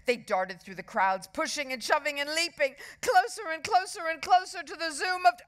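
fast, climbing high and loud; racing towards the crash; breathless chase narration. A narrator's reading. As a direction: build speed and pitch until cut off.

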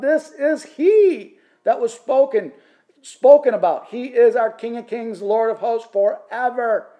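high, loud proclamation; crying out. Acted. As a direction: cry it out like a herald of the king. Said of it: "would all cry out".

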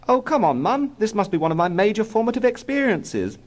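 put-on very high-pitched child voice; protesting small boy. A narrator's reading. Small boy begs Mum to let him stay, protesting.

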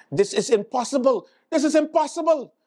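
climbing, high-pitched protest; mock exasperation. Acted outburst of exasperated politician.

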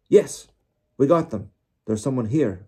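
high, loud triumphant call; shout. A narrator's reading. Searcher reporting a capture to wizards, shouting. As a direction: shout the find back from afar. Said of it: "they heard Mister Diggory shout".

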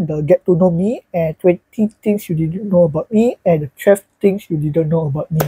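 ordinary talk

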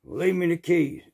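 curt shop guy. Retold or imitated.

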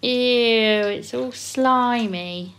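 slow, drawn-out disgust. Acted. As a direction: stretch it out in disgust.

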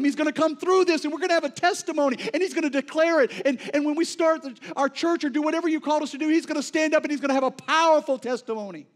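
loud, high, impassioned preaching that slows to drag out each shaken line; preaching at full force. Acted. Preacher voicing the prophet's fear to the congregation, full-force preaching. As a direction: preach it like the storm is already here. Preaching family's hopes for healing.

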